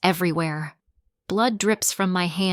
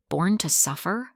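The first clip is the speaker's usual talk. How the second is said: plain reading voice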